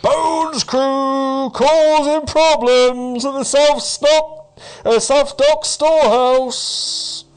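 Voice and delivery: high, drawn-out loud cry; town crier. Acted. Town crier calls a broadcast through the town, calling out. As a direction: bellow it slowly across a whole town. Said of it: "the town crier sort of calling out".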